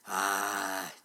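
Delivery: low dragon rumble; rumbling dragon. A narrator's reading. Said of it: "he rumbled".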